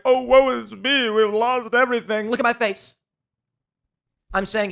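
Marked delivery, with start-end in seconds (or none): 0.0-3.0 hammed-up woe-is-me wail; mock crying. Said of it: "I'm not crying being like".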